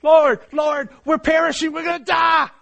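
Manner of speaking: high, loud shout of terror; frantic, high-pitched yell for rescue; panicked screaming. Acted. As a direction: cry out as if about to drown. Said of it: "the screaming and the panic".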